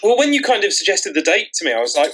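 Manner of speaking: usual speaking voice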